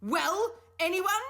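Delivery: loud, high furious demand. A narrator's reading. The professor loudly demands an answer from the children. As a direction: force an answer out of them. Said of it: "he demanded".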